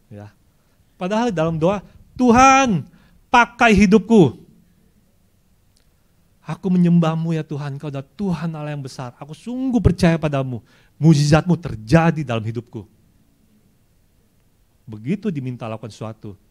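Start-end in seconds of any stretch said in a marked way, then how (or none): 2.1-12.9 much higher and louder, mock passionate praying; fervent prayer. Worshipper praying fervently to God, higher and louder. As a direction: cry out to God, high and loud.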